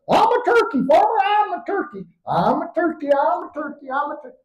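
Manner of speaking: high squeaky bird-like cries; squawking turkey boy. Acted. Boy discovering he's a turkey, high squawking cry. Pleading to farmer.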